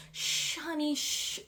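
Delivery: mock-soothing a screaming kid, quietly; hushing parent.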